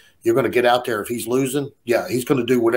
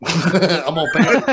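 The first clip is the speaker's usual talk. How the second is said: laughing; 5 semitones higher, much slower than usual. Retold or imitated. Laughs while quoting himself.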